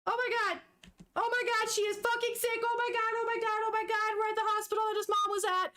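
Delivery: high-pitched, tumbling disbelief; real upset, voice pushed up high; distressed shock. Real, not acted. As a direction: pour it out in a panicked rush. Distraught reader reacts to hospital scene.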